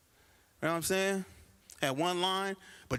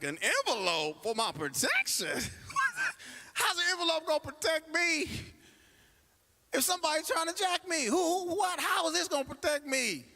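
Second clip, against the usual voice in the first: high, rising disbelief; can't believe what he's hearing; incredulous; 11 semitones higher than usual. Retold or imitated. Customer to the bank teller, high incredulous outrage.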